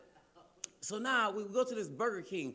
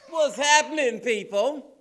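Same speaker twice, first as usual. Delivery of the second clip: big calling-out hello to the room; higher and much louder, hyping the crowd; loud crowd greeting; 7 semitones higher, 12 dB louder, slower than usual. Real, not acted. Comic calling out to the crowd, loud hype greeting.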